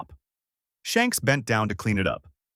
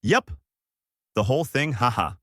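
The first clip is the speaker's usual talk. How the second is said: laughing. Acted. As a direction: answer proudly, chuckling. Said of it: "Goku laughed and said".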